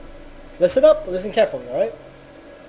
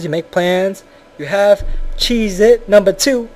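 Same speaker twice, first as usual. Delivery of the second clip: ordinary talk, nothing marked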